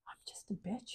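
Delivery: whispered self-mockery; mock-guilty whisper. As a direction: breathe it out as a confession. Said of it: "it was just like".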